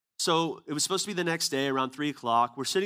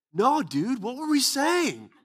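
much higher and louder, slow and astonished; amazed question; 11 semitones higher, 7 dB louder, slower than usual. Retold or imitated. Stunned preacher asking his friend what he prayed, amazed. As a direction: beg to know, stunned. Speaker amazed, asks Adam.

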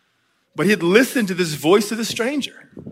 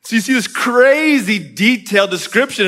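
ordinary delivery

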